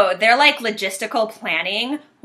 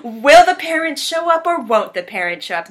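trailer tease; 5 semitones higher, 5 dB louder than usual. Acted. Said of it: "dramatic trailer question".

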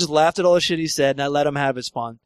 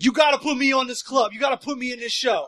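higher, pushy plea from a lightly voiced stranger; eager hustler; 7 semitones higher than usual. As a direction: beg to be booked, a hint of a hustler. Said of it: "And he's like".

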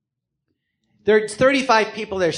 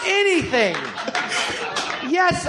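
higher, laughing, overeager; eager laughing yes; 12 semitones higher, 3 dB quieter, slower than usual. Retold or imitated. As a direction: jump at the offer through a laugh. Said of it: "And I said".

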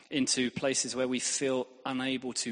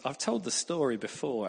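ordinary delivery

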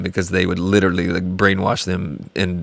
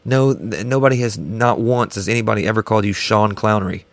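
normal talking voice

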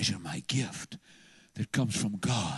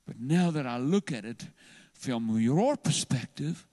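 usual speaking voice

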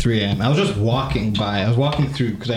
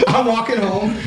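giggling through the words; laughing; 10 semitones higher than usual. Real, not acted. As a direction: laugh as you say it. Laughing while retelling walk home.

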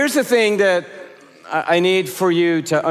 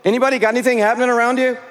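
quick call-out; 5 semitones higher, much faster than usual. Real, not acted. Preacher repeating call to crowd.

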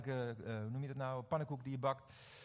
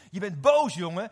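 very loud, raised retort; loud teasing; 3 semitones higher, 14 dB louder than usual. Retold or imitated. Preacher retells confronting his angry friend, loud teasing. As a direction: call him out at full volume. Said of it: "zeg ik tegen hem met een lach".

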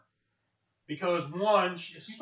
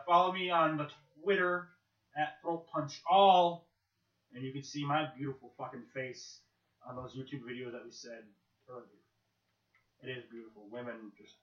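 usual speaking voice

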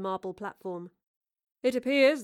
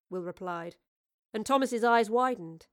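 plain reading voice

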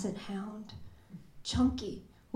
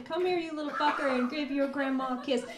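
higher, quicker second take of grandma's call; retried grandma line; 3 semitones higher, much faster than usual. Shown as a demonstration. Poet retrying grandma's line for the audience, lightly higher. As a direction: give it another go, fonder and lighter. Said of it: "We'll try that again".

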